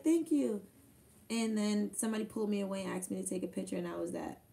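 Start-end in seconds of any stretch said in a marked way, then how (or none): 0.0-0.6 imitating her thanks. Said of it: "she's like".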